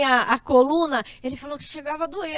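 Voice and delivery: ordinary talk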